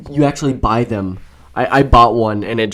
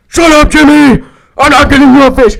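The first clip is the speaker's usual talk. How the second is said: high, loud mock parental yell; screaming parent; 13 dB louder than usual. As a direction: scream back at the nagging kid. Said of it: "It's like they're screaming".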